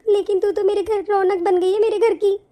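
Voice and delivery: ordinary talk, nothing marked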